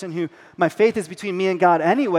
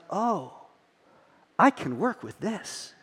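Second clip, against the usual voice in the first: softer, a touch of sly plotting; quiet scheming; 8 dB quieter, slower than usual. Retold or imitated. Dad realising he can use the son's logic, softly scheming. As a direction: murmur it like a plan is hatching.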